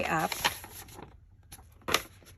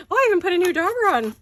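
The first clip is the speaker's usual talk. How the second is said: high, loud and fast through laughter; real laughter, much higher and louder, rushed; laughing hard; 12 semitones higher, 9 dB louder, faster than usual. Real, not acted. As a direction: spill it out mid-giggle. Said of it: "rolling around uncontrollably on the floor".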